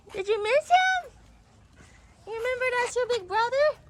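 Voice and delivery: high, sing-song pet voice. Real, not acted. High baby talk to the dogs.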